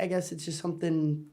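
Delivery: usual speaking voice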